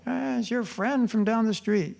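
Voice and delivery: high, sing-song put-on friendliness; imitating a cheery neighbour at the door; friendly stranger voice. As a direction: sweet-talk them through the door. Coaxing strangers to open door.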